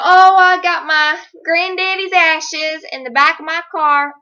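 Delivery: put-on Southern accent of a pleading stranger; southern twang. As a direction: play the panhandler with a Southern accent. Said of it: "where the southern twang's coming from".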